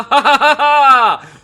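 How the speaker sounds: loud, high villain cackle; evil laugh. Pretend.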